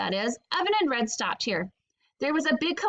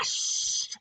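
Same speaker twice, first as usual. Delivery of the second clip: crash sound; 4 dB quieter than usual. Acted.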